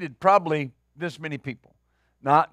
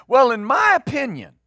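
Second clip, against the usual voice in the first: higher, smug mock of a know-it-all; mocking smug opinion; 6 semitones higher, 3 dB louder than usual. Preacher mocking a churchgoer's smug opinion, higher and mocking. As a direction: mimic the self-satisfied churchgoer. Mocking counselees' stock reply.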